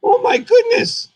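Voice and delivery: very high, slow squeal of wonder; amazed puppet. Acted. Puppet marvelling at a Yoda painting, high and slow. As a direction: draw it out in a piping falsetto.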